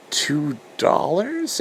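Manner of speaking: high, slow, incredulous question; slow disbelief. Retold or imitated. Hearing the price, asking back high and slow. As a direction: drag it out, unable to believe it. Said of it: "I'm like".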